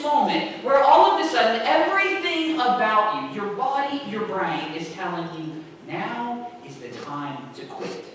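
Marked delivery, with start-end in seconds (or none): 5.9-8.0 slow, low, soft urging; quiet coaxing. Retold or imitated. Tired runner's brain urges stopping, quiet coaxing. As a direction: softly tempt them into giving up.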